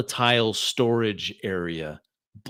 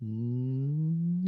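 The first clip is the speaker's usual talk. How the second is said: soft rising electronic hum; robot hum; 3 semitones higher, 6 dB quieter than usual. Acted. Game master imitating robot powering up, rising hum. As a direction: hum like capacitors charging. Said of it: "slight hum coming from that robot".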